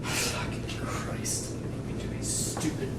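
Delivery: muttered aside. Acted. Actor curses quietly to himself before the monologue, whispered. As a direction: grumble it to yourself, barely voiced.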